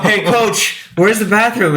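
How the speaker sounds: clueless football player, half laughing; lightly voiced jock.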